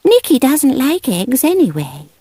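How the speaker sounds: put-on a young girl making excuses for the cat; little girl Debbie. A narrator's reading. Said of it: "Debbie said".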